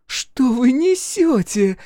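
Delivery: high, loud, drawn-out outrage; indignant flare-up. A narrator's reading. Chloe answers the detective's accusation, indignant flare-up. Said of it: "взвилась она".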